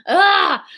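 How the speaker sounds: short high, loud "ah" of exasperation; real outburst of frustration; frustrated yell. Real, not acted. Narrator vents over the two accents, exasperated cry.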